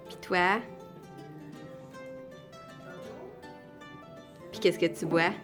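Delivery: calling out. Real, not acted. Mother calling kids at dinner.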